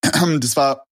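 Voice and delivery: throat catching, coughing; choking. Real, not acted. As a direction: clear throat while choking. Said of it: "ich hab mich grad verschluckt".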